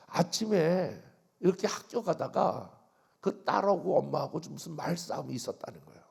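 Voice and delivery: usual speaking voice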